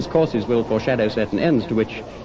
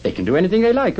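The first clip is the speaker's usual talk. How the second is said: high, louder burst of joy; elated exclamation; 12 semitones higher, 5 dB louder, faster than usual. Acted. Scrooge marvelling at the spirits, high elated exclamation. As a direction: exclaim it with soaring delight.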